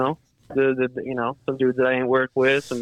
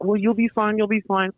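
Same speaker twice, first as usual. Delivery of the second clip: mock reassurance; 9 semitones higher than usual. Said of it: "they're like".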